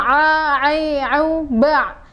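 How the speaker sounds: overdone ain, slow and strained; overdone recitation; exaggerated ain. Shown as a demonstration. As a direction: push the a, ai, au, ba too hard from the throat. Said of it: "padahal itu berlebihan".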